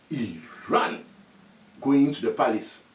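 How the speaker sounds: ordinary delivery, nothing marked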